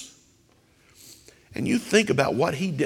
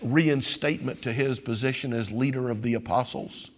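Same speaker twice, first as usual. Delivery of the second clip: usual speaking voice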